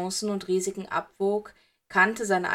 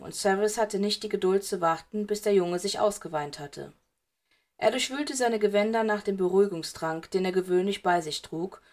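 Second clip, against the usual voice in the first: ordinary delivery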